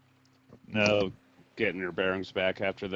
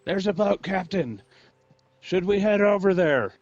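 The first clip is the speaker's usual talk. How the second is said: high, raised sailor's call; overheard shouting; 9 semitones higher, 4 dB louder than usual. Acted. Game master voices the overheard crew hailing their captain, high shout. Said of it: "you guys all hear the same thing".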